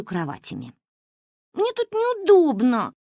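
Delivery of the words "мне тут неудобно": put-on high, slow complaining child; whiny little girl. A narrator's reading. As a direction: whine like a six-year-old. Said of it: "жаловалась она".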